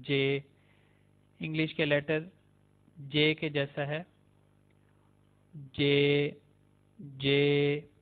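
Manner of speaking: "जे" with an English J sound; Turkish C. Shown as a demonstration. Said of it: "इसको जे कहते हैं". ("जे" अंग्रेज़ी जे जैसा)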